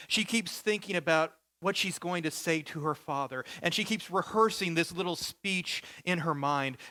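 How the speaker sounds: usual speaking voice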